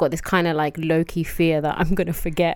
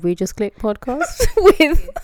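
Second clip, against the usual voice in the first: laughing podcast intro; 7 semitones higher, 6 dB louder, slower than usual. Acted. Intro retake, laughing through it.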